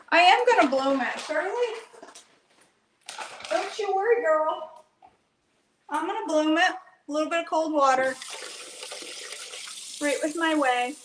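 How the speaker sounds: sassy reassurance to a friend; playful. Real, not acted. Playful talk to camera friend.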